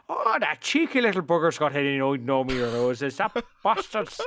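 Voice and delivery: put-on comic Irish gardener accent. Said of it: "if you're trying to do an accent".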